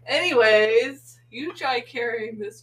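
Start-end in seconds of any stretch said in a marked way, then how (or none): 0.0-1.2 drawn-out laughing anyways. Real, not acted. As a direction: stretch it out through a laugh.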